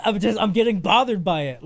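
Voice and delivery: ordinary talk, nothing marked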